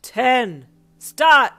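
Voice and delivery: huge shout, rising on the second word; yell. A narrator's reading. Aizawa starting the snowball fight, yelling. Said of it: "Aizawa yelled".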